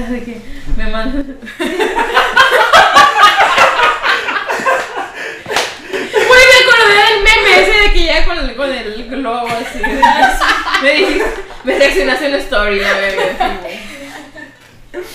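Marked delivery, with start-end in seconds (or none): 1.4-6.1 risa aguda y fuerte a carcajadas. Real, not acted. Carcajada al recordar meme. 6.1-15.0 más agudo y fuerte, riendo; hablando entre risas. Real, not acted. Habla riéndose del meme.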